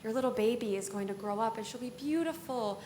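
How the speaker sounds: very sweet. Acted. Evil fairy promising the baby will grow up, put-on sweet. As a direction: coo it softly with put-on sweetness. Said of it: "she switches to be very sweet".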